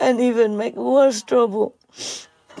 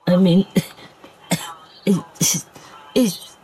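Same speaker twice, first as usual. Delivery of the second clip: coughing through a tight, choking throat; words squeezed out between coughs; choked up. Real, not acted. Speaker choking up while talking about the suffering dog. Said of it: "I choke and I pain in my neck".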